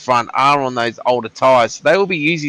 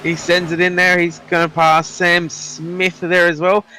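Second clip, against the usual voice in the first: high, rising excited play-by-play; excited commentator; 5 semitones higher, slower than usual. Real, not acted. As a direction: call the surprise move as it happens. Sudden overtake during live race.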